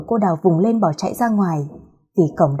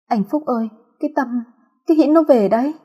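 frantic blurting; 5 semitones higher than usual. Acted. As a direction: rush out the news in panic. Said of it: "rối rít nói". (rối rít hoảng hốt)

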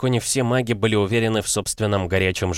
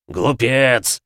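loud growled insult; 5 semitones higher, 5 dB louder than usual. Acted. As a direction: snarl it. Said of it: "прорычал рыцарь".

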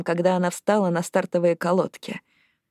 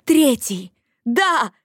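high, triumphant exclamation; excited, raised-pitch cheer; exultant cry; 4 semitones higher, 4 dB louder, much slower than usual. Acted. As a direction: burst out celebrating the result. Exulting at the race result.

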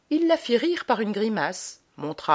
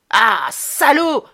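loud, slow outraged yell; a girl shouting at muddy boots on the bed; furious cry; 5 semitones higher, 9 dB louder, much slower than usual. A narrator's reading. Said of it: "cria la fille".

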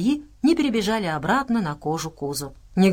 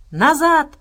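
high, loud barked command; barked order; 8 semitones higher, 7 dB louder than usual. A narrator's reading. Abrams orders the group back, barking. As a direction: bark it out sharply, one word. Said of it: "рявкнул Абрамс".

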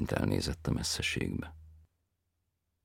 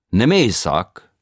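foreign tenor voice; 6 semitones higher, 12 dB louder, much slower than usual. A narrator's reading. Tenor asks.

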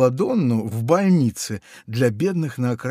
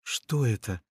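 whisper; 6 dB quieter than usual. A narrator's reading. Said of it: "спросил Фунтик шёпотом".

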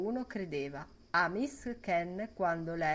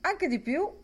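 esclamazione acuta; 10 semitones higher, 5 dB louder than usual. A narrator's reading. Acclamazione al bar.

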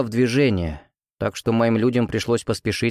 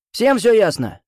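higher, rough barked question to the troops; hoarse powerful voice; 10 semitones higher, 4 dB louder than usual. Acted. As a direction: bark the check at the ranks. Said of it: "Хриплый, но мощный голос".

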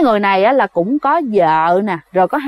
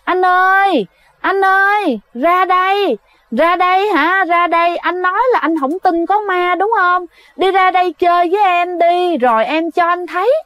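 horror-film ghost; 7 semitones higher than usual. Acted. Ghost calls the disbelieving man out of his tent, high eerie voice. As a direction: lure him out in a high, echoing ghost voice. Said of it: "y như là cái tiếng trong phim ma".

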